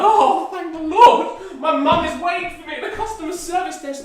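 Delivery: high, loud, laughing relief; relieved outburst. Retold or imitated. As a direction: burst out with giddy relief. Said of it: "when that announcement was like".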